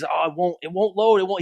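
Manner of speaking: mock whine, rising on "load"; imitating a whiny phone user; sniveling complaint. Comedian's man whining that his phone won't load. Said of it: "sniveling and complaining".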